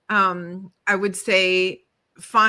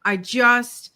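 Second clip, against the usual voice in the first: ordinary talk, nothing marked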